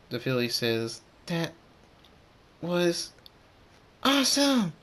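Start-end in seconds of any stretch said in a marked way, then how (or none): 1.3-4.7 higher, louder, drawn-out awesome; excited exclamation. Acted. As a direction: cheer it word by word, building up high. Excited filly after landing.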